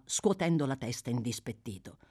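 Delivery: plain reading voice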